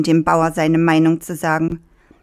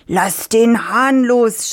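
slow, drawn-out shout, higher; farmer shouting; 6 semitones higher, 4 dB louder, much slower than usual. Acted. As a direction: yell it slowly after the fleeing fox. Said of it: "schrie der Bauer". (langsamer, hoher Bauernschrei)

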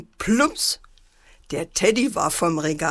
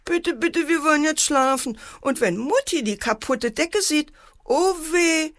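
mildly tearful, high-pitched pleading; sobbing little girl; 8 semitones higher than usual. A narrator's reading. Antje begging her brother to sleep, lightly sobbing. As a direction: plead high, with a touch of tears. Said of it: "schluchzte Antje".

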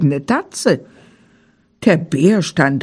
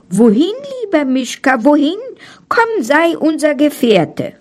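higher, quick, friendly animal voices; forest animals calling; 7 semitones higher than usual. A narrator's reading. Forest animals inviting Mischka along, higher and quick. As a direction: coax the bear to stay with you. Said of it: "Und alle fragten".

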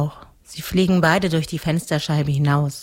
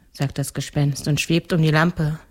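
ordinary delivery

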